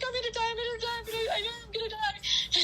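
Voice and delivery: high-pitched panicky whine; mock crying. Scared runner retelling her panic, mock crying. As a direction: repeat it as a hammed-up wail. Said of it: "I was, like, crying".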